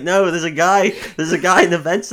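hammed-up protest; mock insistent. Retelling a character claiming a guy is in vents, mock insistent. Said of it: "He's like".

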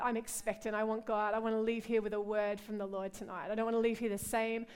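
usual speaking voice

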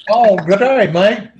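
put-on Aussie accent. Aussie joke greeting.

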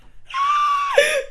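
very high squealing laugh. Real, not acted. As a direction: let out a piercing squeal of laughter. Shriek amid the sarcastic rant.

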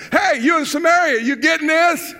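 high, loud call to the back; hollering. Acted. Preacher calling to the overflow section, hollering. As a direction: holler it out to a far-off crowd. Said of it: "I hollered at everyone".

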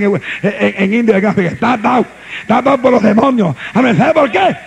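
loud, driving sermon voice; full-force preaching. Acted. Pastor preaching hard to his congregation, full-force preaching. Pastor denouncing sinners in church.

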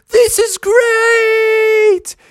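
slow, very high gleeful cry; drawn-out falsetto delight; thrilled shout. A narrator's reading. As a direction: yell it while soaring. Bear thrilled mid-flight.

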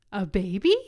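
loud, startled shout with a rising question. Retold or imitated. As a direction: blurt it out in shock. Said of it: "shouted that".

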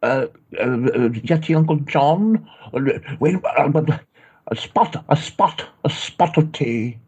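a nervous, bad British accent; fake British accent. American practising a fake British accent for the call. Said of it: "behave like a Brit".

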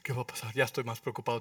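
imitating an anxious believer giving in; mock worried voice. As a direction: fret like someone caught up in worry. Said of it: "vamos a decir".

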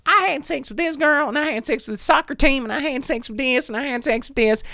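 high exaggerated Southern twang, rattling it off; mock Southern character. Imitating the Southern character bragging, high Southern twang. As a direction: ham up the Southern character's brag. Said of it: "she just runs up and she's like".

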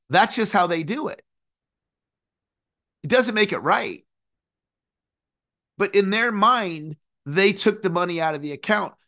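ordinary talk

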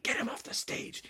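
soft, hissed whisper; urgent stage whisper. Acted. Director orders Froggy off mid-show, urgent whisper.

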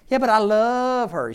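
high, loud protest with a drawn-out "love"; mock a lovesick person protesting; pleading lovestruck objection.

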